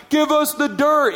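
mock yell. Crowd urging the speaker to spill embarrassing stories, mock yelling. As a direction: playfully retell the crowd shouting. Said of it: "people were literally yelling".

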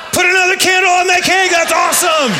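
very high, loud excited cheer. Retold or imitated. Crowd cheering the birthday woman, loud and high. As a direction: shout it out in celebration. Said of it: "we're like".

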